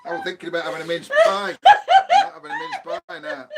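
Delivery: loud, fast then squeaking very high; laughing shout. Real, not acted. Husband joking about mince pie, laughing loudly. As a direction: burst out laughing mid-line.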